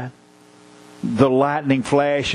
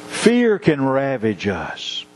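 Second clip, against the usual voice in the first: ordinary delivery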